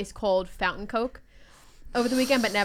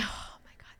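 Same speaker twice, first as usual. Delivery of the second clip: breathy excited gasp; 12 dB quieter than usual. Real, not acted. Nostalgic excitement about books.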